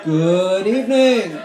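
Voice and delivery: slow, booming hello to the room; loud drawn-out greeting. Real, not acted. Host greets the audience with a slow booming call.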